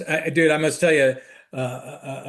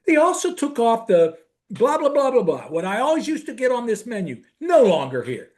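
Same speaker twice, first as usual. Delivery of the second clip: higher, mock-aggrieved voice; brother's complaint; 7 semitones higher than usual. Brother mourning his old menu favourite, in a high mock whine. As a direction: whine about the lost menu item. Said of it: "he goes".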